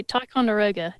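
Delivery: Ticonderoga in an Aussie accent; put-on Australian twang. Shown as a demonstration. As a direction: say the keyword like an Australian. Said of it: "I usually have a keyword".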